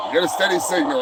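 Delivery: ordinary delivery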